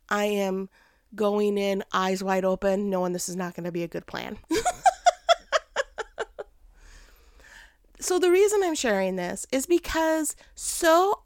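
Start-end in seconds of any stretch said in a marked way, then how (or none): 4.4-6.4 long, high laugh. Real, not acted. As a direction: let a high laugh roll out. Laughing at own plan.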